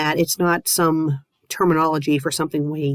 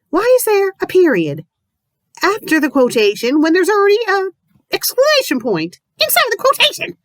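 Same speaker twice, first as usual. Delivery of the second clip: exasperated rant; 12 semitones higher, 4 dB louder than usual. Real, not acted. Reader mocks the punctuation.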